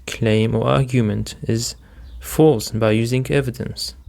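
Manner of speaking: normal talking voice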